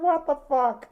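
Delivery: high, loud shocked what the fuck; retold shocked cry; shocked outburst. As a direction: blurt it out in disbelief. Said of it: "I was like".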